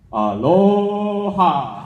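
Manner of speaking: long, high, loud Hawaiian greeting; drawn-out aloha. Real, not acted. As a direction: stretch out a warm aloha.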